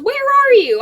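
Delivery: loud, high mock-mom yell; mom's exasperated call. Mom calling her lost child, retold as a high yell. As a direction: imitate a mother yelling down the phone.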